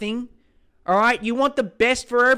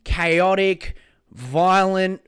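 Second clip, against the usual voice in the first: ordinary talk, nothing marked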